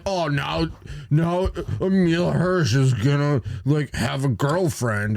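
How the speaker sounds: high, slow whining critic; whiny nerd voice. Retold or imitated. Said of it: "Weasley whiny voice doing it".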